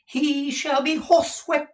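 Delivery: very high, loud dandy bluster; high, hammed-up dandy vow; foppish threat. A narrator's reading. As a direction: bluster grandly like a fop.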